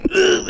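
very high cartoon grunt; effort grunt. Shown as a demonstration. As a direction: squeak out a struggle grunt. Grappling sound effect.

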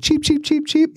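squeaky high bird peeps; tiny bird cheeping. Retold or imitated. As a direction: chirp like a little songbird. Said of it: "a little bird that should be going".

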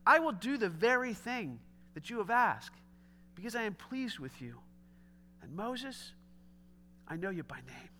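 quiet, gentle voice of God; softly spoken. Retold or imitated. Preacher voicing God promising Moses, quiet and gentle. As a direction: let it fall soft and tender.

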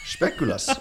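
Speculoos drawn out in a mock foreign accent, rising at the end; playful fake foreign accent on Speculoos; funny foreign accent. Shown as a demonstration. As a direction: say Speculoos like an exotic foreign word. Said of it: "I said it in a funny accent".

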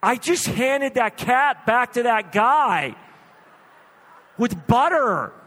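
loud, high, slow mock outrage; hammed-up exasperated husband; appalled protest. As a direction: spell out the disaster in disbelief.

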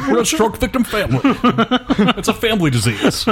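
overacted, laughing ham; hammy stage actor. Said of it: "didn't overact as much as Daniel Day".

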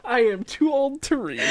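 amused high echo. Retold or imitated. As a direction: echo it back in a high, disbelieving voice.

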